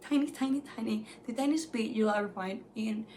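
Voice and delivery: ordinary talk, nothing marked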